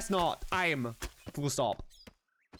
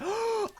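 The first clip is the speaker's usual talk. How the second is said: sharp gasp. Real, not acted. Sudden excitement.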